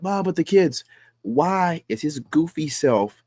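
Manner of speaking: high mock whiny objection; mocking viewers. Host mocking his commenters, a high whiny voice. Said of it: "Some of y'all gonna be like".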